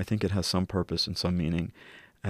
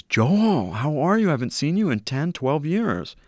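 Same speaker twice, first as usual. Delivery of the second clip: friend's surprised greeting; 7 semitones higher than usual. Retold or imitated. Old friend in wheelchair greeting Joel, higher happy surprise. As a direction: call out his name like an old friend spotting him.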